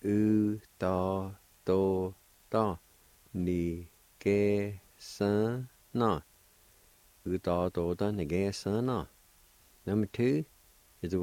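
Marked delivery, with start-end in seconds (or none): 0.0-6.2 "U ta to ta ni ke san na" drawn out very slowly, syllables linked; slow joined-up word. Shown as a demonstration. Teacher modelling the whole Cherokee word very slowly.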